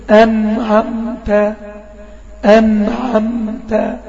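"أنعمت" said very slowly, nun held in between; example recitation. Shown as a demonstration. Teacher reciting "أنعمت" as an example of the nun. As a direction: draw out "أنعمت" gently on the nun. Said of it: "زي كلمة إيه؟".

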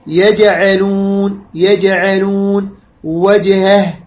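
يجعلون and وجهه slowly, jim bounced; qalqala recitation. Shown as a demonstration. Teacher models Quran words for students, slow qalqala bounce. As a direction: echo the still ج in يجعلون and وجهه. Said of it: "تقلقل، زين".

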